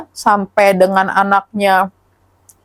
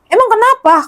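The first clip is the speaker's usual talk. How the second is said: much higher, imitating a puzzled relative; retold startled question; 10 semitones higher than usual. Cousin asks caller what the matter is, startled. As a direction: act out a sudden "why?" on the phone.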